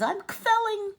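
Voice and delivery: high, loud joyful exclaim; high, loud "I'm kvelling" bursting with joy; proud mother. Acted. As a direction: burst with pride. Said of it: "And she says".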